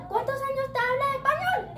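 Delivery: high-pitched Spanish teacher question; imitated teacher. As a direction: lightly play an interested teacher. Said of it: "And she was like".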